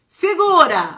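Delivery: loud call to hold on; sharp shout. Acted. Coach shouting segura to the audience, loud. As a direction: shout it out to the room.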